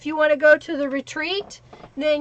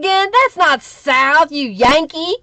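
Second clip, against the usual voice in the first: slow, louder teasing scold; mock indignant; 3 semitones higher, 7 dB louder, slower than usual. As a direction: drawl it out like a proud Southerner scolding a Yankee. Mock scolding a Michigan viewer.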